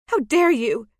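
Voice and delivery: loud, high indignant retort; outraged. Acted. Maggie hurls her outrage at the lord, loud and high. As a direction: fling it back at him, scandalised.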